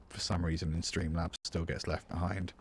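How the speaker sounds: normal talking voice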